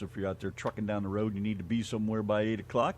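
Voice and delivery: normal talking voice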